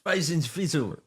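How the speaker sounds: high, put-on "Spitzel" in a fake accent; mock Dutch "Spitzel" again; mock foreign word. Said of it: "that's, like, Netherlands".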